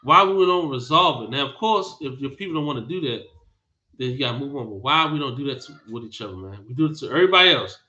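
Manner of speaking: ordinary delivery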